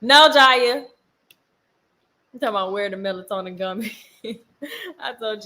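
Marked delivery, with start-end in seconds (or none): 0.0-0.9 loud, higher scolding call; firm parental no. Real, not acted. Calls out to her child.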